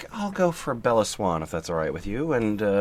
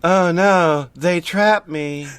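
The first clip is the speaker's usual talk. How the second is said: shouted; 5 semitones higher, 6 dB louder, much slower than usual. Acted. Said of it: "shouted Bella".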